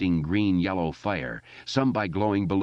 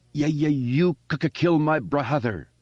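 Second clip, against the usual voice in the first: higher, louder, stammering defiance; defiant stuttering shout; 8 semitones higher, 4 dB louder than usual. Acted. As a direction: spit the stutter out at the monster. Boy defies the monster.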